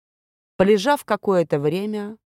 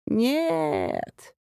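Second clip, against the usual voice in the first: drawn-out no; 5 semitones higher, 3 dB quieter than usual. Acted. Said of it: "протяжно ответила она".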